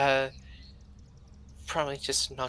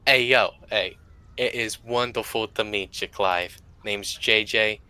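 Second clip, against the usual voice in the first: loud, friendly Brooklyn greeting; hammed-up Brooklyn accent; 4 dB louder than usual. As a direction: introduce everyone in a put-on Brooklyn accent. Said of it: "Are you actually from Brooklyn".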